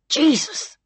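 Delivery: high, tense oath through clenched teeth; tight, through the teeth. A narrator's reading. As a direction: squeeze the word out through your teeth. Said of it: "tightly through his teeth".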